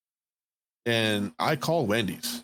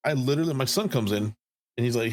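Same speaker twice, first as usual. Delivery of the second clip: usual speaking voice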